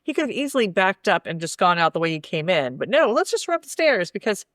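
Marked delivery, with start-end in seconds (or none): 2.9-4.1 higher, sarcastic voicing of his choice; mock decisiveness. Podcast host mocking a movie character's escape plan, sarcastic. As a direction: mockingly play up his silly logic.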